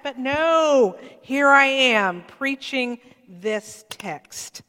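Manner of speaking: loud, slow self-exasperated outburst. Retold or imitated. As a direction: throw up your hands at yourself, slowly. Retold exasperation at himself.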